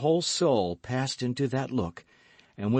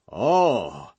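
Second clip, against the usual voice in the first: horrified gasp; 6 dB louder than usual. Acted. Athos horrified at the glass.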